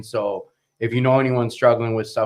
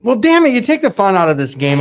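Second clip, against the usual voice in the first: loud, high-pitched playful exasperation; mock protest; 10 semitones higher, 8 dB louder than usual. Real, not acted. Host ribbing guest for spoiling the superpower question, mock protest. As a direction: burst out louder and higher in joking complaint.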